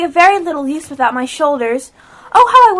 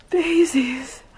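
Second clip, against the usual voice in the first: sleepy whisper; 5 semitones lower, 6 dB quieter than usual. Acted. Alice drowsy in the heat.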